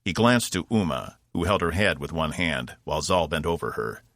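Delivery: usual speaking voice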